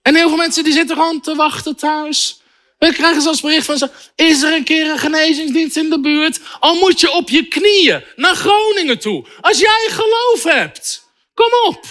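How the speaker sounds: high, urgent exhortation; impassioned preaching. Real, not acted. Preacher urging waiting believers to get moving, impassioned. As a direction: spur them on, pitch climbing high.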